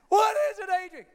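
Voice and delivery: high, loud mock sob; Rocky. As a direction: bawl it impatiently, hammed-up. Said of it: "He's crying".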